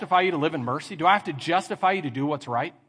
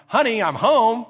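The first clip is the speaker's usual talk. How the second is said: loud, higher-pitched sing-out; calling out on arrival; 7 semitones higher, 8 dB louder, slower than usual. Acted. Husband walking in the door to his wife, calling out. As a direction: call it into the house for all to hear.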